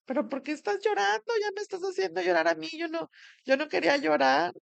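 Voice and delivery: high, fast, choked-up plea; tearful protest. Retold or imitated. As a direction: blurt it out high and quick as tears start. Sister crying while receiving the news.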